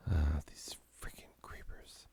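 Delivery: breathy whispered grumble at the creepers; whispered curse. Real, not acted. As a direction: hiss it under your breath, annoyed. Muttering at creepers in game.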